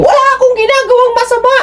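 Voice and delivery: high, fast defensive protest; defensive wife. Retold or imitated. Wife insisting to husband she did nothing wrong, high and fast. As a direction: rush the denial up high. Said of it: "parang kita mo sa kanya defensive".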